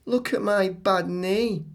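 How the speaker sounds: put-on high childlike girl voice; Claire's voice. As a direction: voice little Claire showing off her knee.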